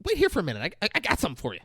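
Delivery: high, halting, stammered excitement; mock flustered mom. Mom rushing off to fetch a gift, mock flustered. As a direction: stumble over it, comically flustered.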